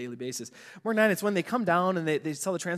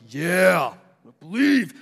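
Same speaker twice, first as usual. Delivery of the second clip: eager yes; 2 semitones higher, 9 dB louder, much slower than usual. Retold or imitated. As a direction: blurt it out, all caught up. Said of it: "I get all caught up. I'm like".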